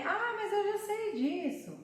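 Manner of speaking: high-pitched imitated pupil; put-on high student voice; pupil's objection.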